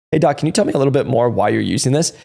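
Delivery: normal talking voice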